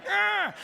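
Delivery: sharp high yelp of frustration; mock outburst at being held back on the plane; frustrated cry. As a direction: blurt a loud exasperated ah. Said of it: "And I'm like".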